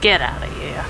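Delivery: bad New York accent. Friend in co-op game doing a New York accent, bad New York accent. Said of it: "that's my Terror Bad New Yorker".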